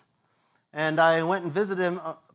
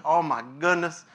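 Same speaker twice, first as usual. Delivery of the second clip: normal talking voice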